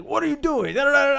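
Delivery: high, loud imitation of an irate host; mock confrontation. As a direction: burst in demanding an explanation. Acting out Taffer confronting the owner.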